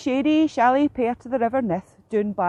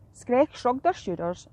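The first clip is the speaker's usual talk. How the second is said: plain reading voice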